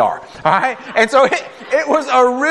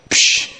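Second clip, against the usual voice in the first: imitated scoffing "psh"; a sharp puff of air, brushing it off; dismissive psh. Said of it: "Other people will say".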